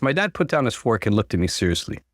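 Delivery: usual speaking voice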